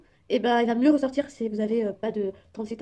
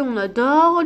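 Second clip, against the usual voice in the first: sarcastic; 3 semitones higher, 10 dB louder, slower than usual. Real, not acted. Mocking her own skin flaws.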